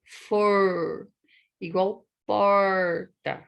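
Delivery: "for" and "porta" with long curled R; drawn-out American-like R; retroflex R. Shown as a demonstration. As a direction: hold the R in "for", then in "porta". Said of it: "faz o som de er".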